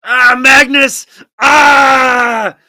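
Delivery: long, high, loud cry of frustration; anguished yell. Real, not acted. Commentator frustrated by Magnus's queen trade, anguished yell. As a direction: wail the name out loud and stretched.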